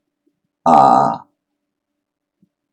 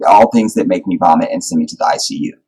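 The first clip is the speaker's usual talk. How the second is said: ordinary talk, nothing marked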